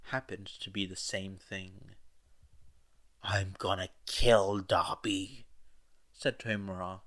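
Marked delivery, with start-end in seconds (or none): 3.2-5.5 louder, slow, seething threat; angry declaration. A narrator's reading. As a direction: spit out the threat slowly, louder. Angry at Dabi burning base.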